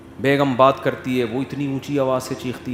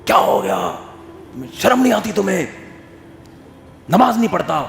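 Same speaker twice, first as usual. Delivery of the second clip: scolding father with raised pitch; mock yelling father; 5 semitones higher than usual. Father shouting at son for missing prayer, mock yell. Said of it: "چیخ رہا ہوتا".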